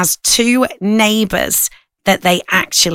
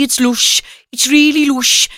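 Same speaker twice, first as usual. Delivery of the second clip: mock Brummie, "lush" said as "loosh" and the line falling at the end; lightly done Birmingham accent with "really" pitched higher; a hint of Brummie, "lush" rhyming with "push"; 5 semitones higher, slower than usual. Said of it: "they say it a lot in Birmingham".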